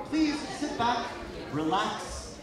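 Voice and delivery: high, loud, stretched-out shout; hyped showman's send-off. Real, not acted. Host sending the audience into the show, hyped shout. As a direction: holler it slowly to launch the show.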